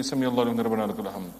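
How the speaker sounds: fast, slurred, words run together; rushed recitation. Shown as a demonstration. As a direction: gabble the phrase carelessly. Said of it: "don't just say".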